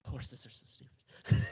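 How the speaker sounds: slow, breathy, unvoiced; half-whispered aside. Real, not acted. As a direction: say it on a breath, slowly. Aside about horses.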